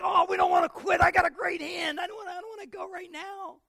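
put-on high, loud whining child protest; squealing boy begging to keep playing; whiny kid. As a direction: whine like a boy who won't leave his game. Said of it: "He's like".

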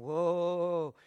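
loud, drawn-out moaning "whoa"; overwhelmed whoa. Retold or imitated. Preacher re-enacts reading a moving message, overwhelmed. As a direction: let out a long, overcome wail. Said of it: "I'm blubbering, I'm crying".

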